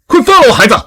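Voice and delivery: high, loud shout at a kidnapper; shouted, high-pitched command; angry demand. Acted. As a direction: demand the child's release at full voice. Parent confronts the kidnapper.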